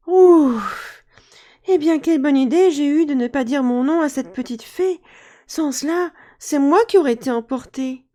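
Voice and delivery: put-on relieved boy. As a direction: sigh of relief.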